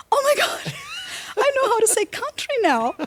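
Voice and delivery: high, loud delighted cry; squealing with joy at finally getting it right; excited squeal. Retold or imitated. A Brazilian woman thrilled to master a word, excited squeal. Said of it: "And I was like".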